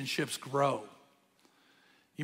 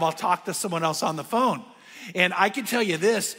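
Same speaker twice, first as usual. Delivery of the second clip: usual speaking voice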